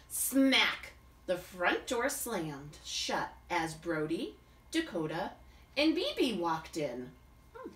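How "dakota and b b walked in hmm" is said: ordinary delivery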